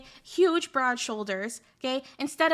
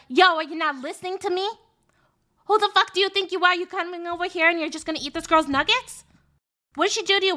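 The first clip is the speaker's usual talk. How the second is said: put-on loud, higher, furious street-girl yell; retold tough-girl shout, higher and louder; tough girl accent; 4 semitones higher, 4 dB louder than usual. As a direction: chew her out like the toughest kid on the block. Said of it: "a very tough girl kind of, like, accent".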